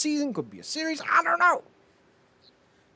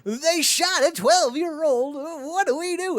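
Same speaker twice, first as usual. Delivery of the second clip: mock horror; 13 semitones higher, 3 dB louder, slower than usual. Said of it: "John Cena in the corner going".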